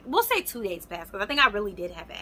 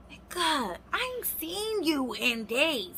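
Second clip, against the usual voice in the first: higher, slow indignant complaint; pissed; 7 semitones higher, slower than usual. Retold or imitated. Woman retelling her anger at boyfriend, indignant. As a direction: let the pique rise in your voice. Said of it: "Now I'm pissed again".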